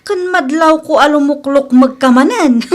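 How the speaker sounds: normal talking voice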